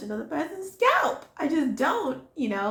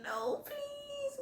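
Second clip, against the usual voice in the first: high, soft whiny plea; high whimpering no please; mock pleading whimper; 12 dB quieter than usual. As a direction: beg weakly like a scared teen. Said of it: "I'm like".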